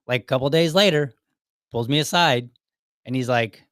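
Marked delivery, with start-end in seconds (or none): none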